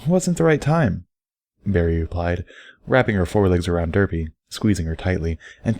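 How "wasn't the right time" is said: lightly raised, teasing mare explaining; a playful, knowing friend giving advice; Barry's voice. Acted. Barry teasing then advising Derpy, lightly higher.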